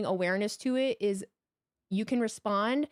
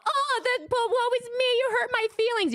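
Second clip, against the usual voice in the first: high, louder whiny wounded voice; mock whiny victim; 4 dB louder than usual. Mocking bullies who cry when hit back, high and whiny. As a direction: parody a hurt crybaby. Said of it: "respond with the".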